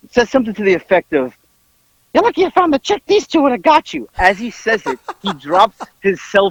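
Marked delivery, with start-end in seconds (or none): 2.1-4.1 high-pitched blustering threat; Howard threatening. Retold or imitated. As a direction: imitate a worked-up man menacing a clerk. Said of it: "trying to threaten this poor kid".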